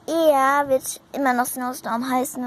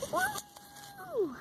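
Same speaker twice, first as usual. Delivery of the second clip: high squeal; 14 dB quieter than usual. Pretend. Horse squeals.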